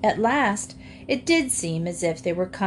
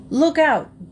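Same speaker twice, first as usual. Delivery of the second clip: warning call; 7 semitones higher, 6 dB louder than usual. Acted. Jinx warns of logs.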